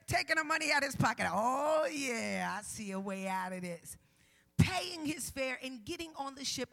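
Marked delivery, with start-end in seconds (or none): 1.3-4.0 mock self-satisfied prophet. Voicing prophet seeing an escape.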